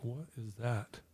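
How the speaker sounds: very soft, wondering aside; puzzled inner murmur. Retold or imitated. Student overhearing talk of fasting wonders to himself, hushed puzzlement. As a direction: murmur it privately to yourself. Silent wondering at an unknown word.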